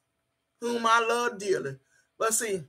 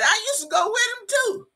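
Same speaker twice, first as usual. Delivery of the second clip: high-pitched comic comeback; playful confrontation; 13 semitones higher than usual. Pretend. Streamer sparring with viewer over a man, high-pitched teasing.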